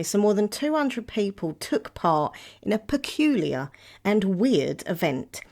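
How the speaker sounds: usual speaking voice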